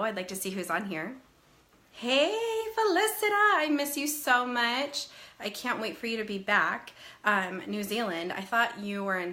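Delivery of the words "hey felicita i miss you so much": excited greeting. Real, not acted. Greeting a viewer she misses.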